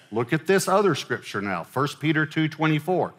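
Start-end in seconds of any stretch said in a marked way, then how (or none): none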